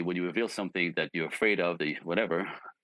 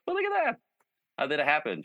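delighted surprise; 9 semitones higher than usual. Retold or imitated. Coach reacts to good news.

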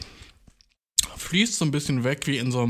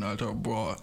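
ordinary delivery

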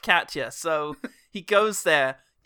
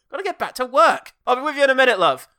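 high, loud mock holler; calling out; 7 semitones higher, 7 dB louder than usual. Character calls to impatient love, calling out. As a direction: shout it across in a put-on voice.

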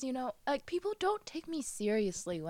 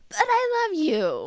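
high, loud melodramatic plea; hammed-up desperate declaration; mock lovers' quarrel; 9 semitones higher, 8 dB louder than usual. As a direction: cry it out like a soap heroine. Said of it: "two people are fighting in a story".

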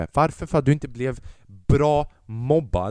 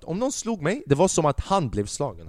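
ordinary talk, nothing marked

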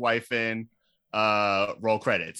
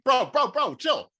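alarmed; 12 semitones higher than usual. Retold or imitated. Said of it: "I was like".

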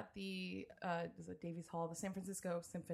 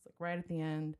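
ordinary talk

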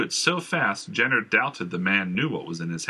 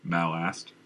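ordinary delivery

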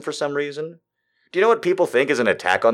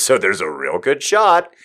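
ordinary talk

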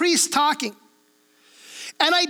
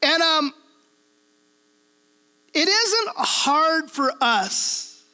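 ordinary talk

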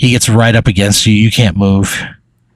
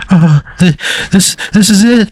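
high, stammering little voice; halting, high-pitched character voice; nervous stammering character; 10 semitones higher than usual. Acted. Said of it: "Shay, Shay goes".

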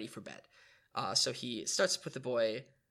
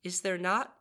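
put-on higher, politely asking little boy; polite child; 6 semitones higher than usual. A narrator's reading. Boy asked if he wants anything else, polite. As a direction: ask politely, lightly voicing a child. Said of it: "he asked again politely".

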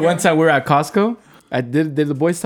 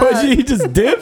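laughing; 9 semitones higher, 4 dB louder, slower than usual. Real, not acted. Listener cracking up.

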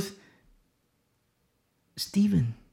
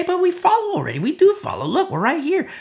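much higher, pleading objector voice; mock protest; 13 semitones higher, 4 dB louder than usual. Imitating a churchgoer protesting to the preacher, high and defensive. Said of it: "a person could say".

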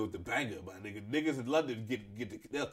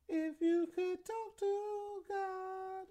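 high, slow, far-off godly voice; mock divine announcement; much slower than usual. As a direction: intone it like a deity. Mock booming voice of God.

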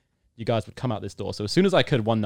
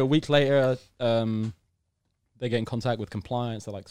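ordinary talk, nothing marked

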